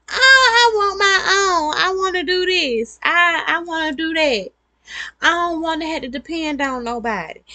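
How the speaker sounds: a hyper-independent woman whining that she wants her own; whiny protest. Retold or imitated. Woman refusing her partner's help, high whiny near-cry.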